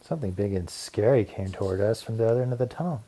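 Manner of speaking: usual speaking voice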